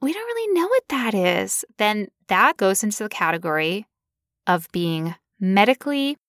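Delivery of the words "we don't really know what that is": higher, musing puzzled doctor. Acted. Doctor brushing off a patient's symptoms, puzzled. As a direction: ponder it in a high, baffled voice. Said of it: "and like".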